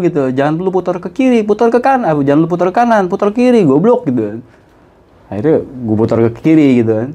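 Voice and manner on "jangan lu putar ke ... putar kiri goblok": retold angry scolding. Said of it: "abang gue marah lagi".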